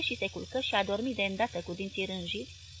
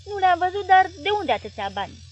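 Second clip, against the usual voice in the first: loud, higher, fed-up snap; exasperated snap; 9 semitones higher, 9 dB louder than usual. Acted. Husband snapping at his wife, exasperated and loud. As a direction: bite her head off. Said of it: "se stropși Paraschiv scos din sărite".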